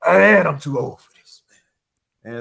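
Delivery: tired, weary movie-cop sigh; a weary, fed-up old cop; lightly voiced Murtaugh-style groan; Lethal Weapon line. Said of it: "one of them Lethal Weapon moments".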